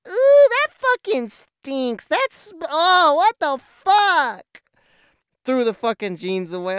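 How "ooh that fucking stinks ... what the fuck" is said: much higher and louder, slow, disgusted; grossed-out outburst. Retold or imitated. Sniffing his jeans, he shouts in disgust at the stench. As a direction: recoil from a foul stink. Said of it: "I was like".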